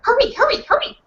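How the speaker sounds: high, urgent calls to hurry. Acted. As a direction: rush them along. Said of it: "repeat after me".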